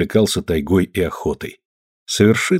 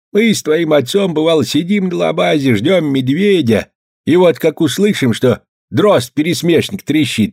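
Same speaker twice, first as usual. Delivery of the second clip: put-on higher, lively old hunter's voice; storytelling old man; 6 semitones higher than usual. A narrator's reading. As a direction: tell it like a fond grandpa. Grandfather tells hunting story.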